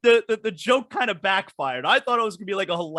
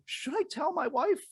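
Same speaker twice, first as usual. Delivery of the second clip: a hushed, higher ask; soft higher quoted question; 8 semitones higher, 8 dB quieter than usual. Retold or imitated. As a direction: half-whisper the question to a friend. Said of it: "I go".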